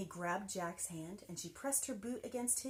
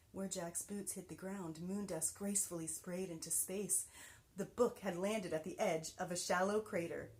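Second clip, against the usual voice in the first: ordinary delivery, nothing marked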